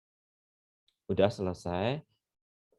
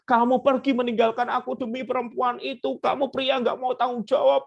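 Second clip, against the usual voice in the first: higher, louder accusing wife; wife scolding the husband who left her, a touch of angry tears; mock angry scolding; 11 semitones higher, 4 dB louder than usual. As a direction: hurl the blame at him. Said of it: "menangis, marah, mencaci maki".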